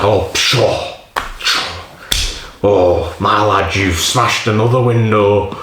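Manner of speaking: mock-cross auntie in a rough Yorkshire accent; angry Yorkshire auntie. Auntie scolding nephew over smashed window, mock-angry Yorkshire accent. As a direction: play a put-out Yorkshire aunt, lightly hammed-up. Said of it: "an angry, like, auntie from".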